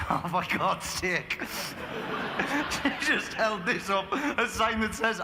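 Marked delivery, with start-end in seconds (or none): 1.5-2.6 airy unvoiced laugh; breathy laugh. Real, not acted. Reacts to lost-voice sign.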